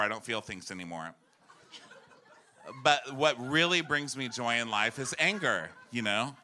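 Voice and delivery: ordinary talk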